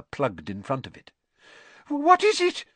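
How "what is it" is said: sharp, high frightened gasp of a question; gasped in fright. A narrator's reading. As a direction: catch your breath in terror as you ask. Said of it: "gasped Aunt Petunia".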